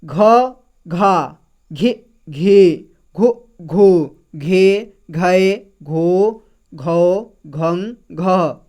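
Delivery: घा, घि sounded out slowly, each apart; slow teacher recital of घ syllables; syllable drill. Shown as a demonstration. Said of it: "और घ का देखिए".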